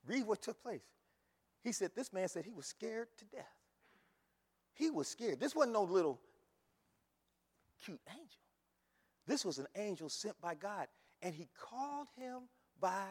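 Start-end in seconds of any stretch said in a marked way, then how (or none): none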